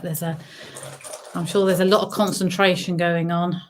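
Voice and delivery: ordinary talk, nothing marked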